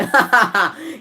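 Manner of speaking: high, amused chuckle at the player; mocking laugh. Real, not acted. Streamer laughing at Harry Kane's handball, mocking chuckle.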